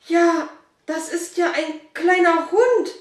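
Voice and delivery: put-on a child seeing a puppy in the kitchen; astonished boy. Boy amazed at the puppy, high and loud. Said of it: "fragte Dominik erstaunt".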